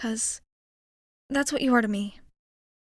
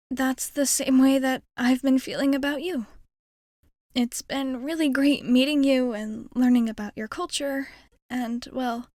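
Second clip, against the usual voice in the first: ordinary talk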